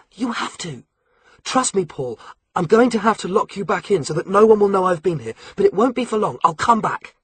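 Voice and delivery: put-on a teenage spy pleading with the boys to wait; Alex urging the captive boys to stay, lightly voiced; Alex, urgent teen. A narrator's reading. Alex voiced reassuring Paul.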